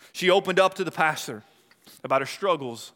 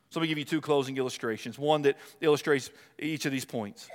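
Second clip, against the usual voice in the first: usual speaking voice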